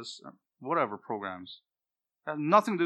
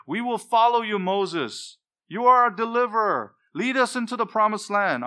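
crowd acclaim; 9 semitones higher, 10 dB louder than usual. Retold or imitated. Israelites hailing Moses voiced.

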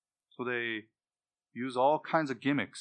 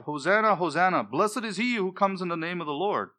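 crying out hosanna; 6 semitones higher, 7 dB louder than usual. Retold or imitated. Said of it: "who cried out".

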